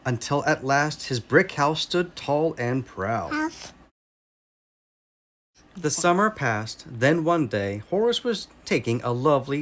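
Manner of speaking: ordinary delivery, nothing marked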